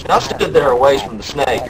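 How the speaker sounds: usual speaking voice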